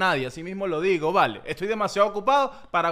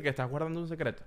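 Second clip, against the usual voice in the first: ordinary talk, nothing marked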